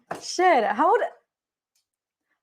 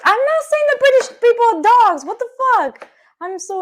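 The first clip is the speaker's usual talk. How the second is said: louder, much higher protest; indignant denial; 13 semitones higher, 9 dB louder than usual. Real, not acted. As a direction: insist you never meant that. Defending against misread joke.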